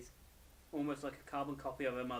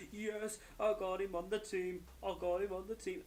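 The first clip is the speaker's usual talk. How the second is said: over the top excited; 6 semitones higher than usual. Retold or imitated. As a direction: gloat like you won big. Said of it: "goes over the top with".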